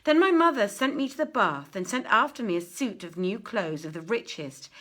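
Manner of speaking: ordinary delivery, nothing marked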